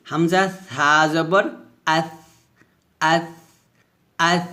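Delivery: আস with a lisped th, tongue at upper teeth; drawn-out spelling, then gentle "ath"; soft hams. Shown as a demonstration. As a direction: let soft air out through th in আস. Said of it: "আগে লাগিয়ে নরম বাতাস বের".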